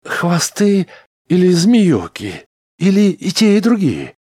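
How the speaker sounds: very high, loud groaning questions; groaned. A narrator's reading. Smith presses Chauns about the alien masters, groaning loudly. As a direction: wail each guess out incredulously. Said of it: "простонал Смит".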